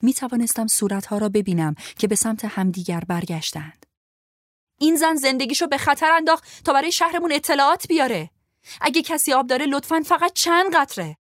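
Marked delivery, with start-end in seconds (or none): 4.8-11.2 high, loud pleading cry; desperate loud plea. A narrator's reading. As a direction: beg the whole wagon for her life. Pleading to wagon for water. (التماس بلند و مستأصل)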